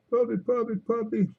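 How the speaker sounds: high cooing at the puppies; puppy talk. Real, not acted. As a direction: coo to them like pets.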